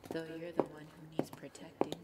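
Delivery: low, secretive whisper; soft whisper not meant to be heard; whispered aside. Acted. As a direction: slip it out under your breath. Sinister aside, then covers it.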